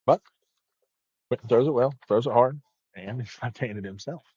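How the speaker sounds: steady bowling broadcast commentary; sports commentary. Acted. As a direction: call the match like a TV commentator.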